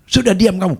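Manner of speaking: angry shout. Retold or imitated. Angry caller silences the pastor, loud and high. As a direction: shut him up, loud and high.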